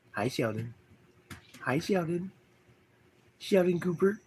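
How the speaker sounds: high, soft cooing to a pet; pet talk. Real, not acted.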